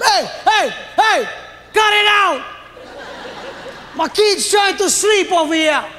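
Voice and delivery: hammed-up scolding father; dad telling them off. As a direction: call out sharply to the noisy neighbours. Dad yelling at noisy dealers.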